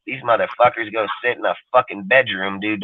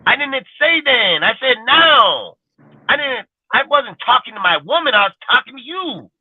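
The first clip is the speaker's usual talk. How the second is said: real exasperation; 11 semitones higher than usual. Real, not acted. Telling off people who answer about something else, exasperated. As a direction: pitch it way up in frustration, stretching the key words.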